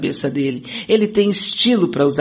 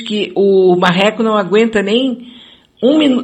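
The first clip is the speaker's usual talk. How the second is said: ordinary talk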